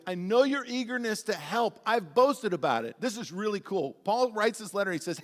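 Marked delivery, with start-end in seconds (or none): 0.1-3.0 sarcastic. Retold or imitated. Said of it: "he's being sarcastic".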